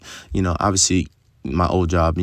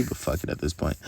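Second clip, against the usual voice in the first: whispered aside; 3 semitones lower, 9 dB quieter than usual. Real, not acted. Aside while naming the boss.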